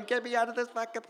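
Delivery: fast, high frantic yell; locked in the washer, screaming fast and high; screaming like a maniac. Retold or imitated.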